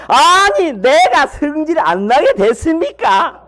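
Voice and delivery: high-pitched indignant outburst, slowing as it goes; indignant protest. Acted. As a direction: justify yourself to God, offended. Jonah voiced indignantly talking back.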